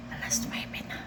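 breathy whisper to herself; whispered "Alas nuwebe na". Real, not acted. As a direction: whisper the hour, exhausted. Whispered time check.

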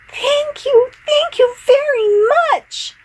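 very high, thrilled shouting; overjoyed. Acted. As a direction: squeal out the thanks. Little fish overjoyed.

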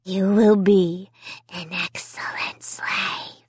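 slow, sinister whisper. A narrator's reading. Dummy claiming Amy as his slave, whispering. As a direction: whisper it slowly into the ear. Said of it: "he whispered in my ear".

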